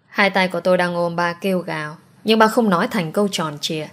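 usual speaking voice

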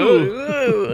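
high-pitched mock ooing; high impressed ooh. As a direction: coo like an impressed crowd.

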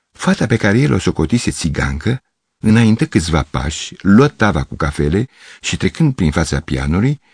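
ordinary delivery

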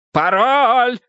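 high, drawn-out demand; exasperated shout. Acted. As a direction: yell it at someone who won't listen. Shouting demand before a slap.

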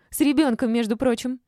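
high, loud retort; indignant shouting. A narrator's reading. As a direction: shout it back in protest. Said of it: "закричала Олечка".

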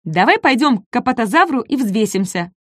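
high, excited small voice; eager little dinosaur. Acted. Ком зовёт Дака взвеситься, радостно. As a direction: pitch the idea with glee.

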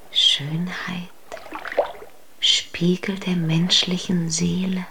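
soft breathy whisper. Acted. Undine whispering of beauty. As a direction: breathe the words dreamily.

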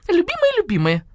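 high squawky copy of her voice; mimicking the woman. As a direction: parrot her wail, high up. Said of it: "голосом Нины Павловны". (высоко передразнивая хозяйку)

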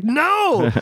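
a hammed-up high no; dismayed no. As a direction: wail the no in comic despair. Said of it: "I was like".

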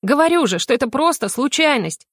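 high-pitched, exasperated insistence; emotional blurt. A narrator's reading. Heroine insists to the inquisitor it was chance, emotional. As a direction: insist on it heatedly. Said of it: "на эмоциях выпалила я".

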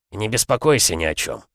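plain reading voice

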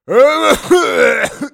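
loud rasping cough; old man's coughing fit. Acted. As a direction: hack away like an old man. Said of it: "переставший, наконец, кашлять".

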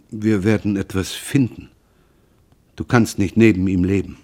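usual speaking voice

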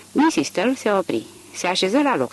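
ordinary delivery

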